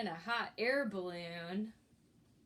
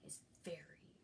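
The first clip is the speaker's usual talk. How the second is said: hushed spooky whisper, no voice; 7 semitones lower, 16 dB quieter, faster than usual. Acted. The shark trick-or-treating, in a creepy hushed whisper. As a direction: breathe it out like a creepy clown. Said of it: "our shark looks very scary".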